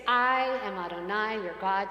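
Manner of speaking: solemn recitation. Shown as a demonstration. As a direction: proclaim it to the congregation. Repeats line for congregation.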